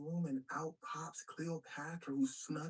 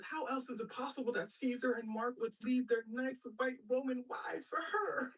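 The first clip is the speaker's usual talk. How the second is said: mock indignation; 6 semitones higher than usual. Octavius arguing against Cleopatra to Romans, mock indignation. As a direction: plead the case in exaggerated disbelief. Octavius incredulous about Mark leaving.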